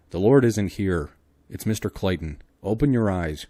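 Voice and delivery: man urging a fainted woman; Clayton's firm call. Acted. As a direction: order her to wake up. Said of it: "cried Clayton".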